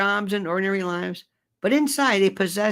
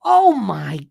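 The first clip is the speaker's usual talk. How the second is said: slow, louder, near-crying oh my God; anguished groan; 5 semitones higher, 4 dB louder, much slower than usual. Real, not acted. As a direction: moan in disbelief. Despair at Ted Williams.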